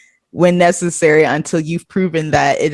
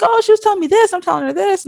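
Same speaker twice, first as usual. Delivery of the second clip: high mock daughter voice; 13 semitones higher, faster than usual.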